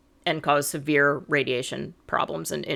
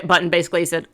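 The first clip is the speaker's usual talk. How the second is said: normal talking voice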